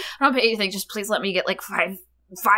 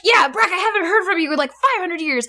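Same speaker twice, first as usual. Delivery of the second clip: high, fast needy dad voice; hammed-up dad begging his son for praise; eager stepdad; 7 semitones higher than usual. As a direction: fish for approval like a clingy dad. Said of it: "Michael Eisner's just like".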